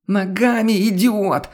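high, cracking screech of insult; a furious woman shrieking insults through the door; hoarse shriek. Acted. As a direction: snap it out in a shrill fury. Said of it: "Сорвавшись на хриплый визг".

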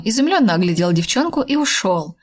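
ordinary delivery, nothing marked

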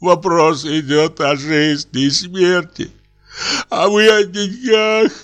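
dying man moaning to Dashenka. A narrator's reading. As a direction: moan her name as if dying. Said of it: "простонал Стрижен".

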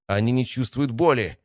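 urgent battle shout. A narrator's reading. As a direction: call it out over the fight. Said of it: "крикнула Кас".

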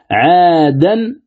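"عادا" slow and rising, ending in a clear n; word sounded out. Shown as a demonstration. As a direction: draw out "عادا" as 'aa-dan. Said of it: "ماذا يصير عندنا؟".

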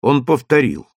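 ordinary delivery, nothing marked

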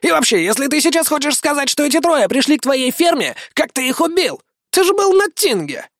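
indignant braggart. A narrator's reading. Iggy denies bragging to the crowd, high insistent voice.

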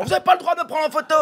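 high, strained outraged cry; hammed-up high-pitched protest; mock yelling. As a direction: yell it like an indignant celebrity. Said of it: "Et il dit, il hurle".

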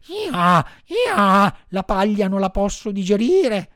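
high, loud braying donkey voice. Acted. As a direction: bray the words out like a donkey in pain. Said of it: "ragliando disse".

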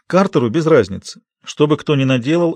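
ordinary delivery, nothing marked

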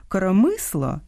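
«коромисло» said Russian-style, stressed on МИ. Shown as a demonstration. Host contrasting similar nouns, Russian stress. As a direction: hit МИ in «коромисло». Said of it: "По-різному слід наголошувати".

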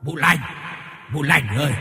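leicht verstellte Männerstimme, rufend; calling man. A narrator's reading. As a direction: ruf wie ein Mann vor der Tür. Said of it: "Có tiếng gọi bên ngoài của một người đàn ông vang lên".

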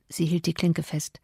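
ordinary delivery, nothing marked

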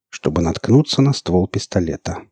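ordinary delivery

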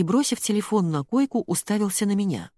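plain reading voice

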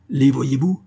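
urgent pointing-out cry. A narrator's reading. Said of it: "criait Friquet".

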